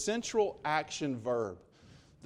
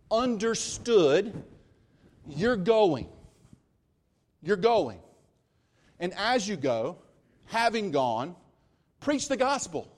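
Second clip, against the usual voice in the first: raised, louder and slower preaching; emphatic paraphrase; 5 semitones higher, 5 dB louder than usual. Shown as a demonstration. Preacher paraphrasing Jesus to the congregation, raised and forceful. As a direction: drive the paraphrase home with force. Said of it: "It's like saying this".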